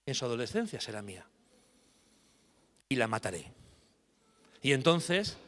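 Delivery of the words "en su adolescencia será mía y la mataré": low, menacing fairy curse; wicked fairy threat. Acted. Wicked fairy cursing Teresa to the parents, low menace. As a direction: promise her death calmly.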